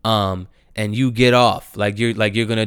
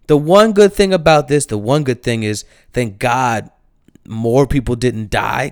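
usual speaking voice

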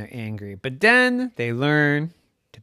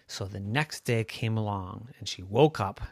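ordinary delivery, nothing marked

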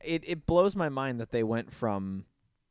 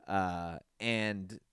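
ordinary talk

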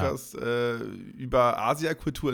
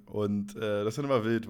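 normal talking voice

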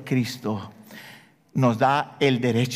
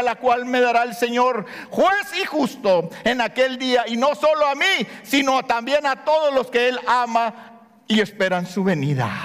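raised, fervent scripture reading; preaching; 8 semitones higher than usual. Acted. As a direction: proclaim Paul's words with conviction. Quoting Paul with fervor. (lectura bíblica fervorosa y elevada)

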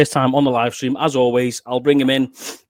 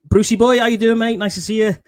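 excited greeting; 8 semitones higher, 6 dB louder than usual. Real, not acted. Host greets viewer brightly.

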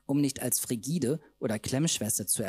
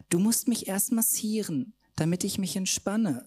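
high coaxing purr; high sweet-talking; 7 semitones higher than usual. Acted. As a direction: wheedle sweetly. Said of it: "säuselte sie".

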